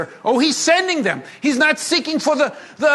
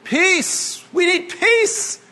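higher, drawn-out dreamy plea for peace; mock peace longing; 7 semitones higher, much slower than usual. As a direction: sigh it like a starry-eyed activist. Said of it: "that's a big one here in Israel".

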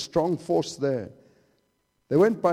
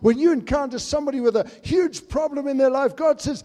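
building preaching; 6 semitones higher, 6 dB louder than usual. Acted. Preaching builds again, God's voice.

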